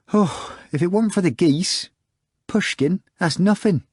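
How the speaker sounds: high, pleading sigh over the geese; a neighbour sighing over his fighting geese; sighing lament. Acted. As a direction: whine it out like a man missing his birds. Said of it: "And he went on sighing".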